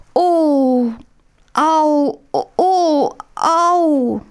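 high, drawn-out moans of pain; wretched moaning. A narrator's reading. Möndlein moans from bed to wake Hähnchen, high wretched groans. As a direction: groan as if the belly aches. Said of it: "ein jämmerliches Stöhnen".

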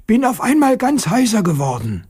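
high schoolboy voice, puzzled; a child wondering at his own voice; boy's voice. Acted. Petja tells Aunt Natascha he went hoarse, high boy voice.

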